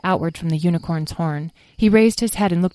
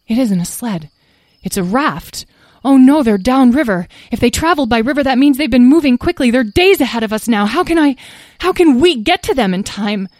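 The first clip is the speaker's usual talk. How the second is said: rising panic; 6 semitones higher, 8 dB louder than usual. Acted. Tom realizes friends are far ahead.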